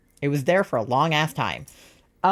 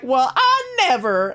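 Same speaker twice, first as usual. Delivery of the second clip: high, drawn-out mock Southern belle; exaggerated Southern accent; 9 semitones higher, 4 dB louder, slower than usual. Jade mimicking Tori's accent from Victorious, exaggerated Southern accent. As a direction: lay on the Southern drawl, laughing. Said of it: "Tori's accent from the bird scene".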